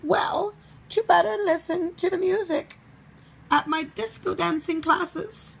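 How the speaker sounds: wolf inventing a lie in a high granny voice; made-up excuse. Acted. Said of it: "Mister Wolf made up".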